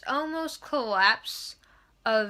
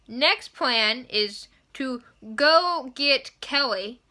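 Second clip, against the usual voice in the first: usual speaking voice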